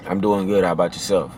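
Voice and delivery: low and soft, seductive reply. Shown as a demonstration. Answering a woman, low soft seductive reply. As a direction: drop it deep and soft to charm her. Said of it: "Be very seductive with a woman".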